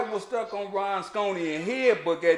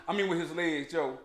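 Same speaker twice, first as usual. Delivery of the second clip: ordinary talk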